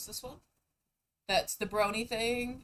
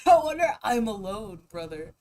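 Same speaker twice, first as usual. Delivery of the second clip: laughing; 9 dB louder than usual. Real, not acted. Laughing self-mockery.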